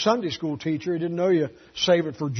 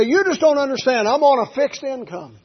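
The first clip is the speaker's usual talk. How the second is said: higher, louder whiny protest; mock complaint about a fixed income, higher and louder; complaining church member; 8 semitones higher, 6 dB louder than usual. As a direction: mimic the member whining about money.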